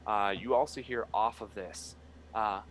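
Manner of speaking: ordinary talk, nothing marked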